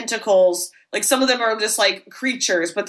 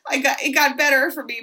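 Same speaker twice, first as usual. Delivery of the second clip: higher, mock-weepy voice; tearful confession; 6 semitones higher than usual. [name] recalls being teased as a kid, mock-weepy. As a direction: plead self-pityingly in a raised voice.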